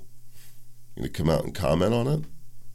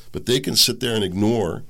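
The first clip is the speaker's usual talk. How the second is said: normal talking voice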